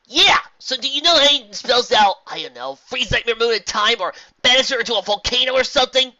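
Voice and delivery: high, loud, excited questioning; brash, impatient pony. Acted. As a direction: fire off ideas at full volume.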